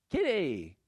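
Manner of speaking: put-on high, happy little-girl call; joyful little Boo. Preacher voicing little Boo as the door opens, joyful. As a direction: imitate a child's delighted greeting. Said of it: "the joy in her voice".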